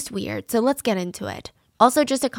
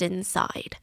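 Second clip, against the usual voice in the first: whisper; 3 semitones lower, 7 dB quieter than usual. Acted. Host teasing a creepy case to listeners, eerie whisper. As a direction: drop to an eerie whisper on the last word. Creepy reveal of trapped person.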